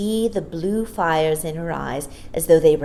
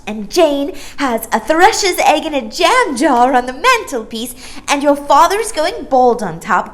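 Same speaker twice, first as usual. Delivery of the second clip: put-on high, loud, breathless little girl; excited little voice; 7 semitones higher, 7 dB louder than usual. Maya to Jane and Michael, excited high little voice. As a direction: spill it all out in a rush. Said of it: "burst out again in her excited little voice".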